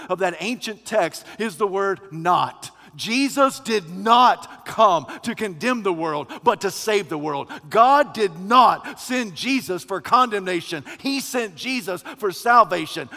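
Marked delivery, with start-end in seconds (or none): 3.0-13.1 louder, forceful pulpit delivery; emphatic preaching. Acted. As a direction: hammer each contrast home. Preacher drives home the word not.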